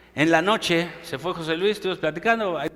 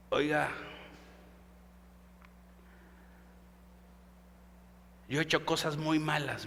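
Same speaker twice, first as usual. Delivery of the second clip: whispered voice; 4 semitones lower, 15 dB quieter than usual. Retold or imitated. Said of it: "así con esta voz".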